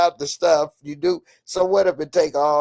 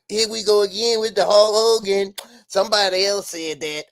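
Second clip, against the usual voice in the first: mock a bored, higher know-it-all voice; mocking dismissal; 5 semitones higher than usual. Mocking the people who brush off Hulk Hogan, bored. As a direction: roll your eyes at the topic. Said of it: "I don't be like".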